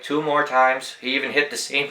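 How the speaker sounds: usual speaking voice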